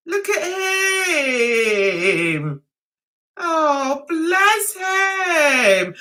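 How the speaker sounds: long drawn-out, doting coo; fond cooing. Real, not acted. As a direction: stretch each word out, cooing over a sweet photo. Showing picture of the cop.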